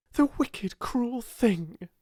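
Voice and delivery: high, indignant burst of feeling; indignant outburst. A narrator's reading. Sarah bursting forth over cook's cruelty, indignant outburst. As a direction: burst out in high-pitched outrage. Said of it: "she burst forth".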